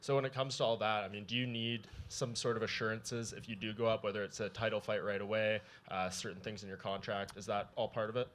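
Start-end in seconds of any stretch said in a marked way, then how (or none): none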